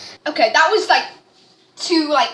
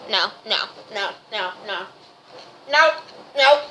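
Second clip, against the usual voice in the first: rapid no no; 4 semitones lower than usual. Real, not acted. Reacting to taste, fast.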